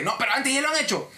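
overbearing customer pushing back; arrogant. Retold or imitated. Cliente que discute la política con el agente, prepotente. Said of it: "la voz, este, prepotente".

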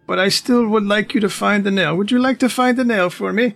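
put-on old spellcaster releasing victim. Old man frees Cecil but asks again, high coaxing voice.

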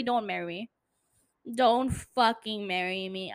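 normal talking voice

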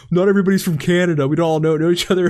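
hammed-up offended stranger snapping back; mock nasty retort. Canadian fan snapping at the podcaster, mock nasty. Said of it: "I got the nastiest, like, response".

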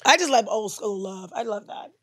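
ordinary delivery

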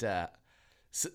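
ordinary talk

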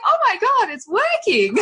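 very high-pitched excited exclamation; acted-out astonishment, pitch leaping up; amazed delight. Mum watches her son breathe himself calm, amazed.

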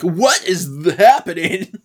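hammed-up stunned reaction; starstruck. Coop reacts to being kissed, acted starstruck.

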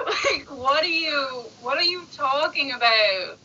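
slow, drawn-out exaggerated disbelief; laughing, long-drawn mock exasperation; mock incredulous. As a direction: stretch each word in hammed-up bafflement. Mocking outrage at nude requests.

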